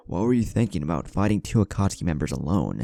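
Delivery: ordinary delivery, nothing marked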